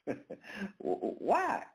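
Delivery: normal talking voice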